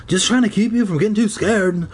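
put-on boy character voice. A narrator's reading. Zeke's voice replying.

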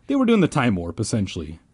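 usual speaking voice

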